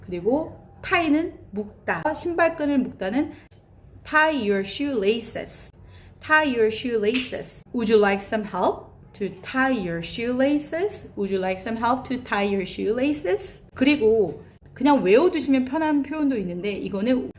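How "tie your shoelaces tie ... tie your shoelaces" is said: very slow, careful model phrase; slow drill. Shown as a demonstration. Teacher drilling a shoelace phrase for learners, very slow. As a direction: build the phrase up word by word.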